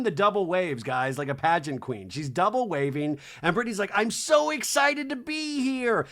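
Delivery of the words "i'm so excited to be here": high, drawn-out put-on gush; mock excitement. Newcomer greeting the audience on stage, high mock gushing. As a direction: imitate a thrilled pageant queen. Imitating thrilled Brittany.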